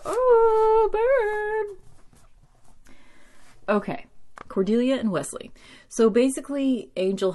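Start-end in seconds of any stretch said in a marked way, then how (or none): 0.0-3.0 squealed, high-pitched oh; gleeful shriek at a good burn; delighted "oh burn". Real, not acted. Reacting to the burn.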